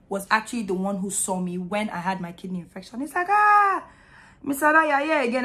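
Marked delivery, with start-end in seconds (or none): 3.3-5.4 surprised doctor. Retold or imitated. Doctor greets returning patient.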